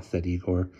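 ordinary delivery

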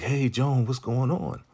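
high-pitched mock hail to a friend; imitated calling out. Couple hailing Joan through the fence, imitated high call.

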